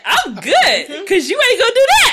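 loud, very high, laughing protest; hammed-up, shrieky insistence; excited concession. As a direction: burst out high and fast, joking. Exuberant concession amid listeners' laughter.

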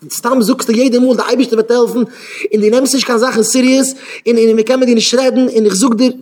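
high, loud acted scolding; hammed-up exasperated complaint; exasperated reproach.

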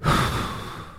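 long, heavy mock sigh, breathed out without voice; a drawn-out, put-upon exhale; imitated weary sigh. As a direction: sigh like she's had enough of everything. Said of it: "the first thing she did was go".

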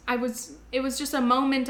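usual speaking voice

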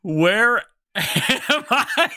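slow, high, laughing bewilderment; dazed laughing. Acted. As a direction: laugh through the confusion. Player reacts to possession, laughing.

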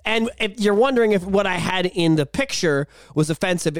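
ordinary talk

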